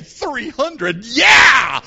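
thrilled youths. Acted. Youths down here hearing three hundred, excited. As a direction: burst into a loud cheer on the last word. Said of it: "All of our youth are down here saying".

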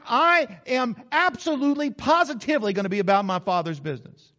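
forceful stress on "absolutely" and "positively"; emphatic. Shown as a demonstration. Preacher allowing no argument, emphatic "absolutely, positively". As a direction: punch "absolutely", "positively" with no backing down. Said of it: "He was emphatic about it".

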